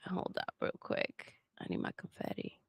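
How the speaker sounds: soft unvoiced whisper; whispered aside. Real, not acted. Streamer whispering an aside before confetti. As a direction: whisper it off-mic to yourself. Aside while reading super chat.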